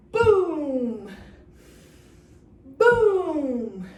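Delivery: loud booming thunder. Shown as a demonstration. Teacher leads kids in loud thunder booms. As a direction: drop a big boom with the arm. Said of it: "let's start with our thunder".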